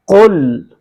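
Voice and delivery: firm, carefully articulated कुल; proper Qaf. Shown as a demonstration. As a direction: say कुल full, from the back of the throat. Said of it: "बाकायदा आपके तलफ़ुज़ की".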